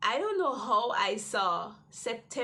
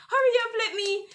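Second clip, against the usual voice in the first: urgent teacher; 11 semitones higher, 8 dB louder, faster than usual. Retold or imitated. Teacher urging her.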